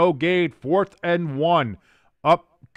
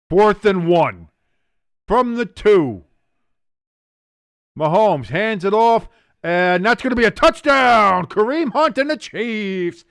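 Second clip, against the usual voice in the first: loud touchdown call, a bit higher; excited call; 6 semitones higher, 4 dB louder than usual. Real, not acted. Streamer calling the Chiefs touchdown, loud and excited. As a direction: rise to the score.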